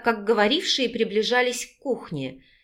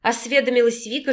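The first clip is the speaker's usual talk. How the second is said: ordinary delivery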